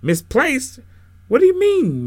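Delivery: loud, high-pitched disbelief; incredulous yelled question; indignant shout. A narrator's reading. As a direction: shout back from the pews in disbelief. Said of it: "Mister Fisherman shouted from the congregation".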